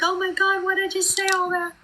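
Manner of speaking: high, exasperated self-reproach; scolding herself. Retold or imitated. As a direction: pitch way up, mock horror at her own oversharing. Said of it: "So then I'm like".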